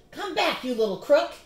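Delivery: loud, high angry bellow. Acted. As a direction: chase him down with your voice. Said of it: "he bellowed".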